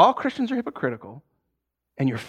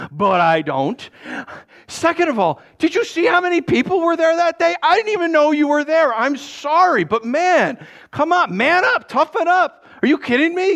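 higher, louder exasperated tirade; imagined rant; 11 semitones higher, 4 dB louder than usual. Retold or imitated. Pastor venting his unspoken anger at the offended man, a loud mock tirade. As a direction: let the inner rant rip, mock exasperated. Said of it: "That's what I wanted to say".

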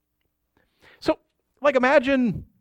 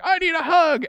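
put-on crying kid voice; 12 semitones higher than usual. Crying kid asking for hug.

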